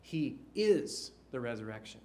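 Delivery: "is" weighted in "He is the resurrection"; stress on IS. Acted. Preacher correcting a weak view, stress on IS. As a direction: lean hard on "is". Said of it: "He didn't simply facilitate".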